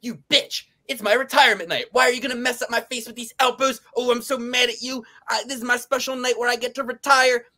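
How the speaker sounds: high, whiny mock voice of a fighter; petty fighter complaining, mocked in a high voice; mocking Michelle Waterson. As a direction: whine it like a sulking fighter. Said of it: "I saw her saying".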